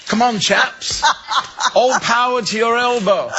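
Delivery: high, hearty English encouragement, laughing through it; terribly English cheer. Retold or imitated. Urging the sculptor hands on politely, a high hammed-up English cheer. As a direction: cheer them on like a jolly English gent. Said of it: "being terribly English".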